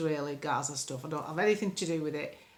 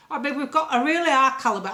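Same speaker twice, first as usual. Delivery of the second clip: higher, louder put-on toff voice; mock posh politician; 5 semitones higher, 6 dB louder than usual. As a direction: send up the people running the country. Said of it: "I'm talking like this 'cause that's how I think they sound".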